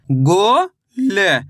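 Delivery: गो ल stretched very slowly, syllable by syllable; sounding out slowly. Shown as a demonstration. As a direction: draw each sound out for the pupils. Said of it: "कैसे पढ़ेंगे". (बहुत धीरे, अक्षर खींचकर)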